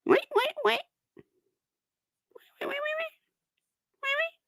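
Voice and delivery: very high squeaky repeated wait. Real, not acted. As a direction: coax the rabbit in a tiny squeak. Eager squeals at the pet.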